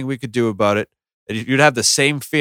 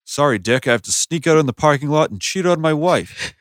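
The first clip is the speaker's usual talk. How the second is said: higher, loud anchor voice; mock newscaster; 6 semitones higher, 4 dB louder than usual. Mocking anchors confessing an affair, higher. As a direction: deliver a scandal like a news read. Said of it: "do they talk like that in fucking normal conversation".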